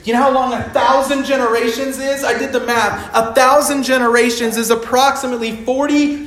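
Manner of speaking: loud, high, building preacher's cry; rising to a full-throated climax on Forever; fiery preaching. Acted. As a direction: preach it to the rafters.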